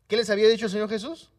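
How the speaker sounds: usual speaking voice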